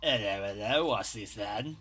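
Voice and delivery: a hammed-up fictional Cockney thug; mock Cockney accent. Shown as a demonstration. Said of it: "Anytime I attempt to do a British accent".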